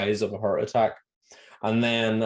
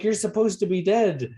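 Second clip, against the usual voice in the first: mock shock; 9 semitones higher than usual. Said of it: "it's like".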